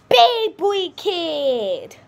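high, loud, very drawn out; showy title announcement. Pretend. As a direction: stretch it out big and babyish. Announcing the fourth kid type.

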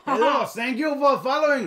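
hammed-up French accent, growing loud and high; cartoon Frenchman, chuckling; weird French voice. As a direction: burst into exuberant mock-French thanks. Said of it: "you get to talk in a weird voice".

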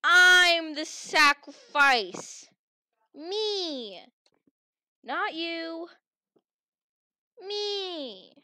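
loud, slow, savoring gloat; slow triumphant boast. Acted. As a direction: stretch it out in triumph. Gloating at being chosen.

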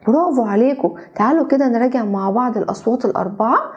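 ordinary talk, nothing marked

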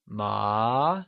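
"ma" long, climbing steadily from low; mid-rising tone. Shown as a demonstration. Teacher asks which tone, "ma" in rising tone. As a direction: slide "ma" slowly upward. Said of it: "second or the mid-rising tone".

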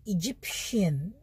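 इजिप्शियन said slowly with a clear shi sound; careful model word. Shown as a demonstration. Teacher showing how to say the word, slow and clear. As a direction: draw out इजिप्शियन for students. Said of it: "इसको कैसे बोलेंगे".

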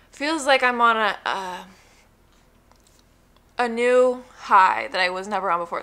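talking while chewing gum; words around a wad of gum. Real, not acted. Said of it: "I have gum in my mouth".

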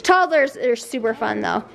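ordinary talk

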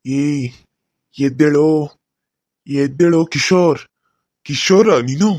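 loud ghostly call to wake him; haunt him awake, loud and eerie. Acted. Ghost calling Kishore to wake up, loud and eerie.

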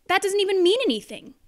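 high, rushed complaint; fast indignant protest. Acted. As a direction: blurt it out in disbelief. Reader protests the silly book.

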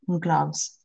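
soft, low whisper to self; half-whispered note to self; whispered aside. Real, not acted. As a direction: murmur it quietly to yourself. Murmuring to self while writing.

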